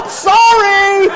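high, loud, drawn-out sorry; mock apology. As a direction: belt out a slow comic apology. Mock apology over tipping story.

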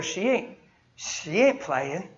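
ordinary talk

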